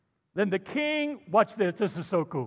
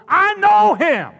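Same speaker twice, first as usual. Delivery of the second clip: preaching shout; 11 semitones higher, 9 dB louder than usual. Real, not acted. Preacher climax.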